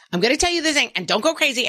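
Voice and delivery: high, fast mock warning; hurried anxious parent. Parent warning kid before a meltdown, hurried mock pleading.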